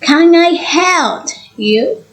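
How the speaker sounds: slow question with "you" rising high; rising intonation. Shown as a demonstration. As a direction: lift "Can I help you" up at the end. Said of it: "the tone rises at the end".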